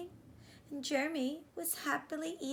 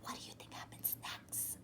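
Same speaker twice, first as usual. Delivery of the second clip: hushed unvoiced suspense; whisper; 14 dB quieter than usual. Teacher whispering to the children for suspense. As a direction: lean in and whisper the secret.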